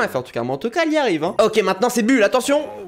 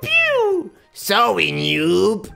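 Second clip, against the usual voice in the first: mimicked high gun noises, then the taunt; Colt impression; 14 semitones higher, much slower than usual. YouTuber showing he can do this game line, playful mimicry. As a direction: copy a game hero's pew-pew and taunt. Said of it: "celui-là, je sais le faire".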